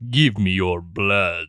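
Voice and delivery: low, slow vampire voice after a staccato laugh; Dracula. Pretend. Co-host offering a replacement sound effect, hammed-up Dracula. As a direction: ham up a deep, drawn-out vampire. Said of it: "you just wanna do a Dracula?".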